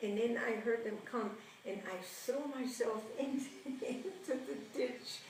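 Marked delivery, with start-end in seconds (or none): none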